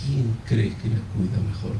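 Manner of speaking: soft, low, hushed question; voice from God. Retold or imitated. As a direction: ask it quietly, like an inner voice. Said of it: "así me dijo".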